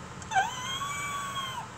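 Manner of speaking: a sharp, high gasp of shock. Retold or imitated. Retelling the moment she saw her son's picture, a sharp high gasp. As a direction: gasp in sudden disbelief. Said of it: "I was like".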